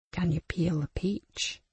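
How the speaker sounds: slow menacing whisper; sinister whisper. Acted. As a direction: breathe the threat slowly. Abuser whispering threat.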